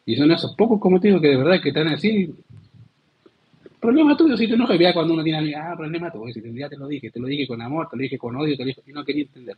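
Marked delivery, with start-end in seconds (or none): 3.8-4.8 high, sharp "your problem"; a friend's blunt brush-off; blunt retort. Acted. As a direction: toss it back, not my fault.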